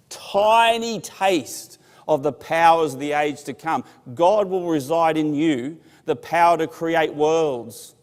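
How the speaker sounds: slow, loud, weighty sermon build; slow, loud preaching. Acted. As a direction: lean on every phrase like a revelation. Preaching at full force.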